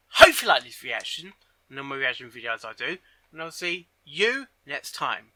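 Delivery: ordinary delivery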